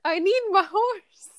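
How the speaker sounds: high, slow, distressed Southern belle. Acted. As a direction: wail for the lost horse like a Southern belle. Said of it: "You sound like a Southern belle".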